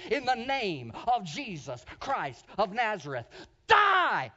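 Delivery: a man commanding the snake to die; commanding shout. Retold or imitated. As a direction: rebuke the snake with full force. Retold rebuking the snake.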